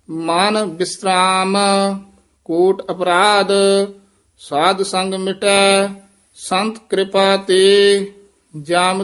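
measured, chanted reading of Gurbani; slow scripture recitation. Acted. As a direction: recite each phrase slowly with pauses between. Teacher recites Gurbani line by line.

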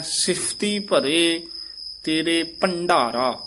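slow "ਸਿਫਤੀ" with careful ph, pause after "ਭਰੇ"; pure ph sound. Shown as a demonstration. Teacher modelling the letter, slow exact reading. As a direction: articulate the ph of "ਸਿਫਤੀ" slowly. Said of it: "ਫੱਫਾ ਸ਼ੁੱਧ ਬੋਲਣਾ ਹੈ".

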